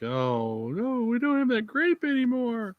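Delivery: high-pitched mock wail; lamenting French. The French lose their grape, high mock lament. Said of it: "the French lost that grape, and they're like".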